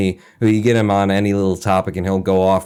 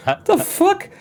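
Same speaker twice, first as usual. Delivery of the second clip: much higher, stunned professor; baffled professor; slower than usual. Retold or imitated. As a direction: squeak it out in disbelief. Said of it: "and be like".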